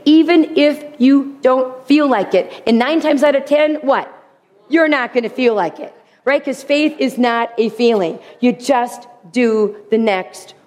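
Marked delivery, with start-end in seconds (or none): none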